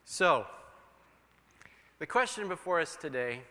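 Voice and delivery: usual speaking voice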